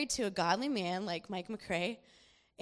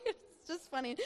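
ordinary talk

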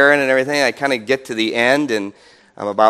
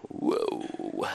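whispered whoa; 14 dB quieter than usual. Retold or imitated. Mock admiration of own ministry.

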